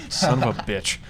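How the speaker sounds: normal talking voice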